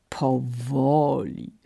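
a slow, drawn-out grinning word; grinning crocodile. Acted. Crocodile mocking the monkey's request, slow and grinning. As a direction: stretch it out with a wide grin. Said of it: "uśmiechnął się szeroko krokodyl".

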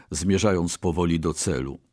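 plain reading voice

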